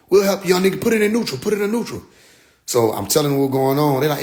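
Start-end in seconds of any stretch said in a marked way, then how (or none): 0.1-2.0 higher, raised helper's voice; imitated bystanders directing a driver; elders calling instructions. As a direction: call it out to someone at the wheel. Elders call instructions at stuck car.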